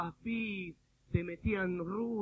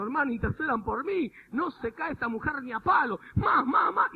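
loud, raised pleading; mock desperation; 7 semitones higher, 11 dB louder than usual. Preacher mimics pastor seeking intercession, loud desperate plea. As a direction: imitate a desperate pastor begging for help. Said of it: "miraba para los costados como desesperado".

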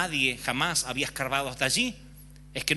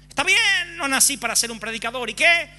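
much higher, louder defiant outburst; defiant shrug; 9 semitones higher, 7 dB louder than usual. Retold or imitated. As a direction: toss it off defiantly. Resigned believer quote burst. (arrebato desafiante y fuerte)

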